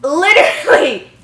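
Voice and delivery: loud exasperated cry. Real, not acted. Reader giving up on the bad grammar, loud exasperated cry. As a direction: throw up your hands with it.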